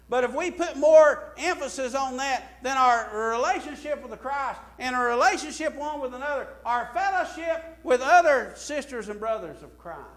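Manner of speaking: loud, high mock quote from the pulpit; fervent sermon voice; preaching at full force. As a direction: ring it out to the saints. Preacher rising on a point.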